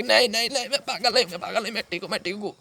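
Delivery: high, panicked protest, fading slightly; desperate denial. Acted. Protests to men that he's sane. (घबराहट भरा ऊँचा इनकार)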